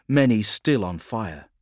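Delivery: ordinary delivery, nothing marked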